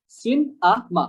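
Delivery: "Cin-a-ma" in three separate pieces; syllable breakdown. Shown as a demonstration. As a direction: break "Cin-a-ma" with a clear middle ah. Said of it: "ডিফারেন্সটা". ("Cin-a-ma" ভেঙে ভেঙে, মাঝে আ)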